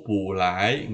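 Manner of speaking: "pu lai" with pu rising instead of falling; wrong second-tone pu. Shown as a demonstration. Teacher shows how not to read it, rising pu. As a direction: lift "pu" as well as "lai". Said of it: "jadi tidak dibaca pu".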